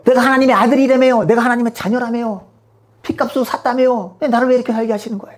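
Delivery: high, whining protest; plaintive complaint. Acted. Believer protesting hardship to God, whining.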